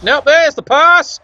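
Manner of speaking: excited call. Real, not acted. Commentator calling a risky pass to viewers, excited. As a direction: call the catch with real excitement.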